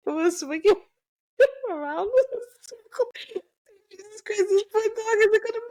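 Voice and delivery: high, laughing, aghast; laughing disbelief. Real, not acted. Mother tells friend her toddler swung the dog, laughing aghast. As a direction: cry out in amused horror.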